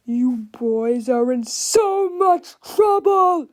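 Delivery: loud, slow angry shout; Mister Krupp. A narrator's reading. Krupp storms in on the boys, shouting slowly. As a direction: draw out a furious threat. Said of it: "Mister Krupp shouted".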